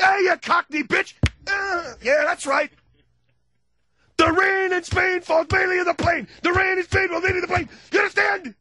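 very high, loud ranting lesson; hammed-up shouting tutor; screaming professor. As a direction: drill her by yelling.

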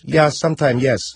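lightly put-on Middle Eastern accent; mock Middle Eastern accent. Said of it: "I'm doing the accent".

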